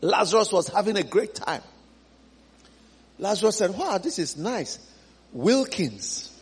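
lounge back, enjoying drinks in heaven; relaxed Lazarus. Acted. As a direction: Lazarus savoring heaven, lazily content.

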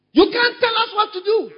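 high, loud mocking shout; hammed-up defiant yell; defiant member. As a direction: cry it out like a rebel who won't be ruled. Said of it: "That's an independent spirit".

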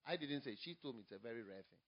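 usual speaking voice

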